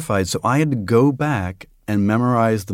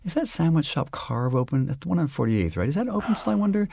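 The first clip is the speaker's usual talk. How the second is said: higher, idle chatter to oneself; wandering inner thoughts; 3 semitones higher, 6 dB quieter, faster than usual. Shown as a demonstration. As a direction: let stray thoughts tumble out, pitched up. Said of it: "this is what my mind is doing".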